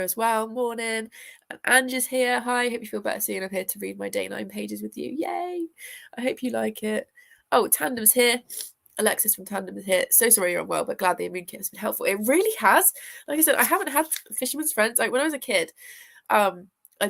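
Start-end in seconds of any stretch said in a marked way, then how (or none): none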